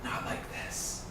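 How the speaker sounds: hushed, breathy voice in your head; eerie whisper. Acted. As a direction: whisper it like a voice inside the mind. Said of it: "you hear a voice in your head that says".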